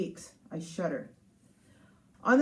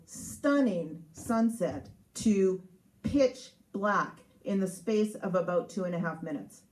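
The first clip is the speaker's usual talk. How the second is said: ordinary talk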